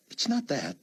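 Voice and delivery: lightly voiced Chloe. Narrator voices young woman.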